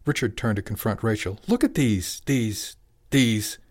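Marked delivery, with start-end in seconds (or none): 1.5-3.6 jab each repeated word out separately; raised, exasperated demand with pauses; angry insistence. A narrator's reading. Father confronting wife over son's carvings, exasperated.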